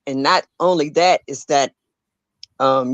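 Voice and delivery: ordinary delivery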